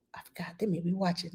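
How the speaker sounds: real whisper, letting slip a secret. Real, not acted. Whispering to her viewers about the surprise for her bosses. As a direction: hush it, as if the bosses might hear. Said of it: "Let me hush".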